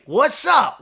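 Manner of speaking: loud, high excited hello; excited greeting. Real, not acted. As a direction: call out a big cheerful hello. Greeting a viewer joining chat.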